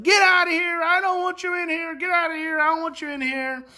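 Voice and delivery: high, loud mock tantrum; imitated angry yelling. Upset boy ordering carer from room, mock angry yell. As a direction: imitate a distraught kid throwing someone out.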